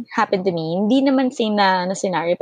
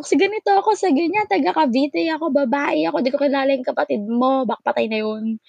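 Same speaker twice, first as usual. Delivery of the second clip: exasperated rant; 7 semitones higher than usual. Retold or imitated. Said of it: "Sabi ko".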